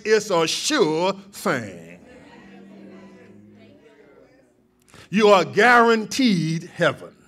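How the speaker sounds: rising pulpit fervor, loud and ringing; full-force preaching. Acted. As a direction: lift the church, proclaim it.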